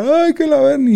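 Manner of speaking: high whiny mock of a crying boy; crying kid mimicked. Retelling how he cried as a boy, mock crybaby whine. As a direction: imitate yourself as a sobbing kid, hammed-up. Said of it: "Y me puse a llorar".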